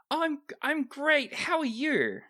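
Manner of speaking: very high, chirpy character voice; high, upbeat friendly reply; Seeker, very chipper. Acted. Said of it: "Their voice is very chipper".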